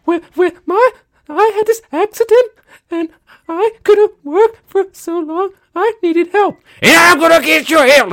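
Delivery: high, loud, sobbing plea; crying woman impression. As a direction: wail it like a weepy ad victim. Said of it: "almost the crying woman".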